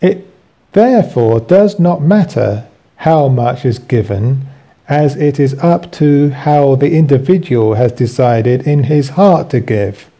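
ordinary delivery

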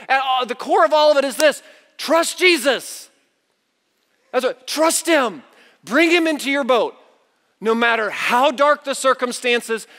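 high, driving sermon delivery; preaching. Acted. Preaches at full force.

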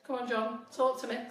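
normal talking voice

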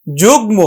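যুগ্ম with g and m both sounded; correct pronunciation. Shown as a demonstration. Teacher correcting the reading, যুগ্ম as jug-mo. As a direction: keep the m audible after g in যুগ্ম. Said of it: "এটা উচ্চারণ হবে কিন্তু".

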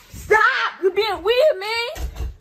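high, loud, whiny protest; upset shout. Real, not acted. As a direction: cry out for them to quit it. Being sprayed with alcohol, protests.

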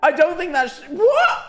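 high, squeaky flustered agreement; comic incredulous protest, very high; flustered disbelief. Retold or imitated. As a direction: agree frantically, then shoot up into disbelief. Retelling his flustered, disbelieving agreement.

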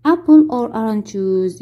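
drawn-out question for the class; slow it down word by word; very slow model reading. Shown as a demonstration. Teacher as flight attendant, slow model question. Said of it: "واحد بيكون هو المضيف للطيران".

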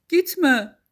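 high, loud acted call; calling after someone. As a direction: prince calling out urgently. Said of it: "diye seslenmiş prens arkasından".

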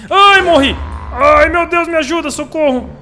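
panicked high screams for help; shriek, terrified and loud; screaming in fear. Real, not acted. Caught by the monster in game.

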